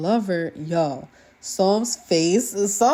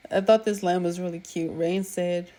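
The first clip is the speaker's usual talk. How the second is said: ordinary delivery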